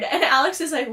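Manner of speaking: ordinary talk, nothing marked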